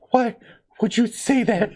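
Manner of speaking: high, whiny mock-wounded question; mock hurt mother. Retelling the comedian's mom whining at her son, high mock hurt.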